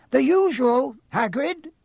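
put-on high, friendly old barkeep; old bartender. Bartender greeting Hagrid, high and cheery. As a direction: lift the voice into a cheery old innkeeper.

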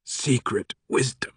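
slow, softened sarcasm; sarcastic. A narrator's reading. Sato answering the professor's ancient mysteries, sarcastic. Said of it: "with more than a hint of sarcasm".

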